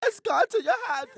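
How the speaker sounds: high, loud, odd English voice; fidgety English accent. Acted. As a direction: push it out as an agitated English oddball. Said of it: "sound like a weird English, fidgety".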